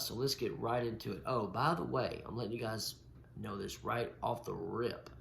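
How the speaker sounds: ordinary talk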